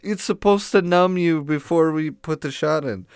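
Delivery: high, put-on voice mimicking the dentist's promise; sarcastic high-pitched dentist impression; mocking the dentist. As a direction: send up the dentist in a squeaky voice. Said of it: "They say".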